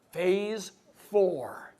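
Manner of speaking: slow, hushed whisper. Acted. Building suspense for the audience, whispered slowly.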